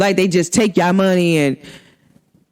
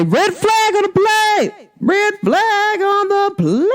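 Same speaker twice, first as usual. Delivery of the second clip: put-on high, slow, drawn-out old man's call; mock referee call, stretched high and slow; granddad's saying; 12 semitones higher, slower than usual. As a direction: sing it out like grandpa calling a foul. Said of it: "My granddad used to say".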